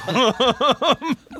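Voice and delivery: high delighted laugh; burst of laughter. Real, not acted. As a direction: laugh out loud at the memory. Laughing after telling tooth story.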